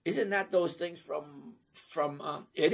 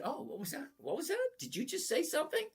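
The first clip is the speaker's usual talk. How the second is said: mock surprise; 9 semitones higher, 6 dB quieter, faster than usual. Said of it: "I said".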